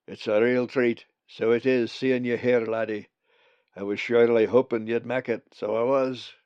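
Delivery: broad friendly Scots voice; scottish accent. Acted. Bugs the raven speaks.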